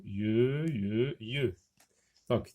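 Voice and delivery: normal talking voice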